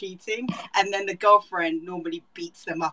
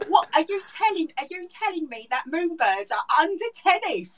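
high-pitched British disbelief; British trash-TV accent; 9 semitones higher than usual. Shown as a demonstration. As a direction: do an outraged British talk-show guest, hammed up. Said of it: "the entire morning show in this accent".

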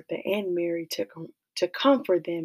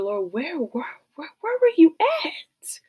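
anguished cry; 9 semitones higher, 4 dB louder than usual. Acted. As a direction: voice cracks high on where were you at. Crying out to God.

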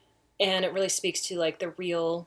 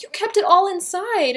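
high, loud incredulous exclamation; retold astonishment; 12 semitones higher, 10 dB louder than usual. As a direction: exclaim it in acted disbelief. Mother retells her astonished reaction.

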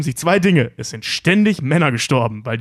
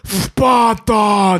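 lisped shout of Sparta; slushy lisp; 6 semitones higher, 4 dB louder than usual. Retold or imitated. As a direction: yell it with a heavy lisp. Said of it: "Der lispelt da mega in der Szene".

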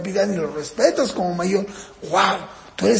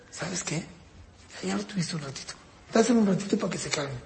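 much softer, coaxing request; gentle parent; 6 dB quieter than usual. Retold or imitated. Parent gently persuading the older child to share, soft and reassuring. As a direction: ask kindly, almost hushed. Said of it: "parent gently asks child".

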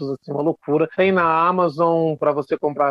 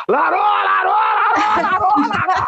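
much higher and louder, ringing like through a megaphone; booming echo; 4 dB louder, slower than usual. Retold or imitated. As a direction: call out as if the chamber amplifies it. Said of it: "parecia que eu tava com megafone". (saudação ecoando como megafone)

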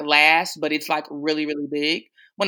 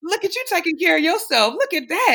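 playful praise; 12 semitones higher than usual. Real, not acted. Teasing praise of co-host.

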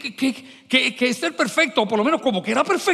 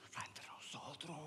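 confiding voiceless whisper; 6 semitones lower, 31 dB quieter than usual. Real, not acted. Preacher confiding to the congregation, voiceless whisper. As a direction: lean in and whisper it like a secret.

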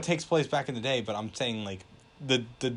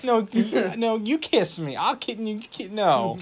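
mock flirting; 8 semitones higher than usual. Acted coy flirting bit.

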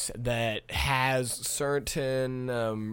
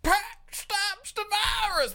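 much higher, slow hammed-up newsboy shout; mock headline; slower than usual. Imagining stoners beating covid, a high mock headline cry. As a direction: cry it out like a silly tabloid headline. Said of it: "It's like".

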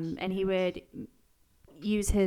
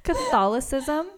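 high, loud, laughing over the word; giggling while saying it; 8 semitones higher, 6 dB louder, slower than usual. Real, not acted. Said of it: "making me laugh".

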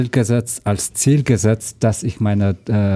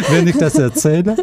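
laughing; 12 semitones higher, 4 dB louder, faster than usual. Real, not acted. Laughs about watching Soko Leipzig.

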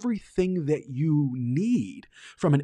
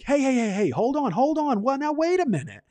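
high, eager calling back as people leave; hammed-up flustered principal stopping the crowd; excited Belding; 11 semitones higher than usual. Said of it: "Belding is like".

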